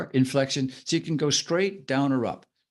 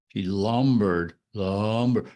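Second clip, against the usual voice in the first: low, dragged-out LUMBERED; lumbering delivery; 4 semitones lower, much slower than usual. Retold or imitated. Co-host imitating a guest, slow lumbering delivery. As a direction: make the word itself lumber along slowly. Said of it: "they made the sound of lumbering".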